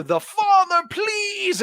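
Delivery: high, strained mock plea; mock pleading Luke. Luke begging his father, high strained mock plea. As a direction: beg in a pained high voice. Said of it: "you know".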